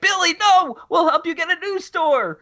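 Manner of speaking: high-pitched desperate pleading; hammed-up high plea to a killer; pleading victim. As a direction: beg the killer in a squeaky voice. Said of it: "And they could have been like".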